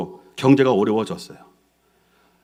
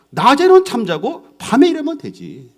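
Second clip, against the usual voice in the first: upbeat retort; 7 semitones higher, 4 dB louder than usual. Acted. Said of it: "생각을 바꿨어요".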